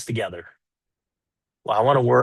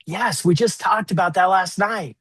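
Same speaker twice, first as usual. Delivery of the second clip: much higher, slow and pointed; sarcastic wife; 8 semitones higher than usual. Retold or imitated. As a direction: drag out the reproach. Wife voiced replying sharply.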